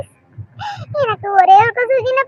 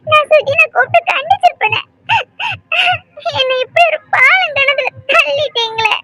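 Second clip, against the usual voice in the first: tearful pleading; 5 semitones higher than usual. Acted. Daughter pleading with mother, crying.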